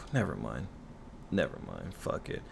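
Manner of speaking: sighing give-up. Real, not acted. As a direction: let the rant die on a sigh. Giving up on the rant.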